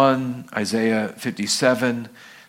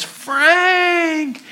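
loud, high, stretched-out welcome; delighted greeting; 5 dB louder than usual. Acted. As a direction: greet him like a long-lost friend. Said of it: "Peter looks at him and says".

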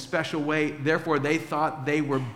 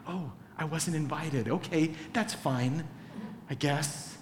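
much softer, deflated and let down; mock disappointment; 2 semitones lower, 8 dB quieter than usual. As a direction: sigh it out like the uninvited guest. Not invited, disappointed.

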